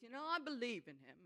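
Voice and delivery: ordinary talk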